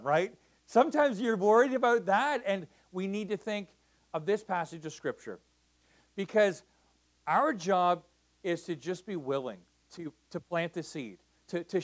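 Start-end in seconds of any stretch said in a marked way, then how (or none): none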